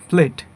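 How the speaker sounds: প্লেট with a short pure e; common mispronunciation. Shown as a demonstration. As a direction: say প্লেট as "plet", no glide. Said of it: "এটাকে আমরা বলি".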